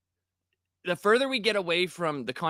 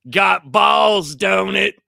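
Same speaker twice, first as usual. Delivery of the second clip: much louder proud boast; 4 semitones higher, 10 dB louder, much slower than usual. Retold or imitated. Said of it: "he's like".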